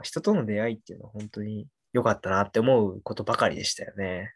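ordinary talk, nothing marked

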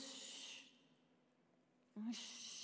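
long quiet "shh"; acted, drawn-out shushing; soft silencing shush. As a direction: hush the voices softly. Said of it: "calla esas voces".

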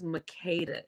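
"Makeda" as muh-KAY-duh; "Makeda" with a long ay in the middle; correcting pronunciation. Shown as a demonstration. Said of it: "the way that I pronounce it is Makeda".

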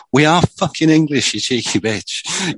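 indignant retort in an imitated Scouse accent, pitched high; mock-outraged Scouse comeback. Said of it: "in that Scouse accent".

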